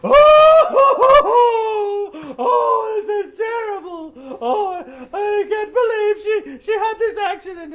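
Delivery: overdone howling sobs, high and very loud; loudest fake crying. Anansi outdoing the mourners at the funeral, fake wailing. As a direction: wail it, high and very loud, hamming up the grief. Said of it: "he cried the loudest".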